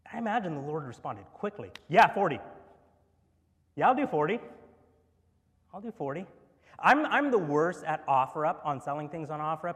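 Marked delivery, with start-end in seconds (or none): 1.9-6.2 higher, louder, rushing to agree; eager quick yes. Acted. Pastor voicing the Lord eagerly granting mercy, loud and high. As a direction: agree before he finishes asking.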